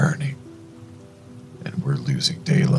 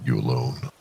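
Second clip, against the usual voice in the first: flat voice; 3 semitones lower than usual. A narrator's reading. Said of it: "His voice flat".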